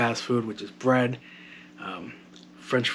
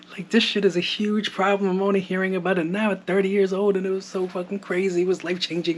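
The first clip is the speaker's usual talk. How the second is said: stunned disbelief; 8 semitones higher than usual. Retold or imitated. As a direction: voice jumps much higher, worked up. Retelling shock at the news.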